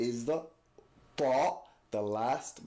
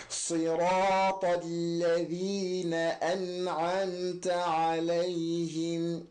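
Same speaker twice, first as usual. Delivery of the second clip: Quran recitation; 4 semitones higher than usual. Shown as a demonstration. Teacher reciting the Fatiha ending as practice. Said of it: "put that into practice".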